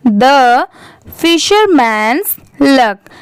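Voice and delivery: very slow, word by word; slow classroom read-aloud. Shown as a demonstration. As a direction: read it out slowly for learners. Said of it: "अच्छे से read करके देखते".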